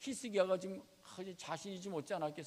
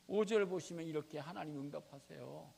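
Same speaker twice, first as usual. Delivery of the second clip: ordinary talk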